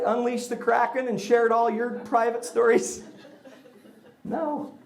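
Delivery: ordinary talk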